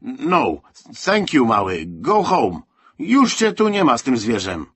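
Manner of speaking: forceful, irritated shooing; vehement dismissal. A narrator's reading. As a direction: chase the boy off loudly. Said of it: "ruszył gwałtownie w kierunku chłopca".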